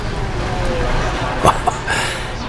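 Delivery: a short airy chuckle under the breath; soft breathy laugh. Acted. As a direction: laugh quietly to yourself. Ad host amused by washing machine.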